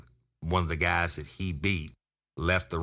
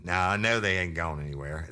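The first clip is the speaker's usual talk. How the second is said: ordinary delivery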